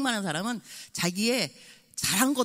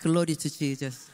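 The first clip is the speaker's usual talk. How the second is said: slow, low pious drone; mother teasing her son, mock solemn; solemn payback; 4 semitones lower, much slower than usual. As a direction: turn his own line back on him. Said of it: "내가 뭐라 그랬겠어요?".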